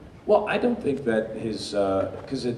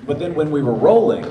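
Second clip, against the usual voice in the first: ordinary delivery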